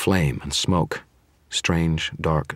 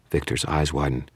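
usual speaking voice

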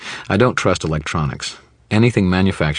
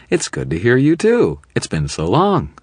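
much higher, sickly-sweet mock gushing; sugary gush; 9 semitones higher, 4 dB louder than usual. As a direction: lay the sarcasm on thick. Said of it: "I gushed, overflowing with saccharin".

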